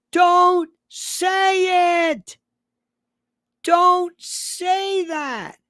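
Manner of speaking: high, drawn-out yelled plea; hammed-up exasperated scolding, stretched right out. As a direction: yell each word long and high. Cuts off the quoted wrong phrasing.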